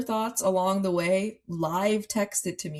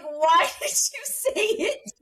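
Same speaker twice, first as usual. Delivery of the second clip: laughing disbelief; 11 semitones higher, 4 dB louder, slower than usual. Retold or imitated. Incredulous retold reaction.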